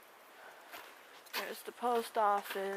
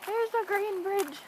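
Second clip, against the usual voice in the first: excited; 11 semitones higher, 7 dB louder, slower than usual. Real, not acted. Spots the bridge excitedly.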